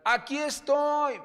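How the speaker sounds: loud high yell; mother yelling across the museum; shouted call. Retold or imitated. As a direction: call out so he can find you. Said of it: "te voy a gritar".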